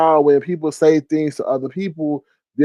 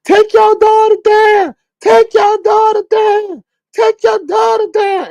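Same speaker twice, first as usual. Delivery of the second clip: high, loud, slow repeated yell; screaming; 6 dB louder, slower than usual. Retold or imitated. Retelling Pizarro yelling at a mother, loud high scream. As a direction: scream it over and over at her. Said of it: "Pizarro was screaming".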